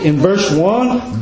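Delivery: usual speaking voice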